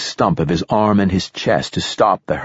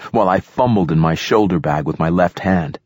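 ordinary delivery, nothing marked

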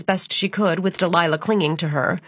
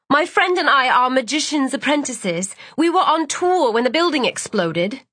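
higher-pitched, insistent character voice; pitched-up, pressing explanation; urgent young apprentice; 6 semitones higher than usual. A narrator's reading. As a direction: appeal urgently to get his attention. Calling for a policeman's attention.

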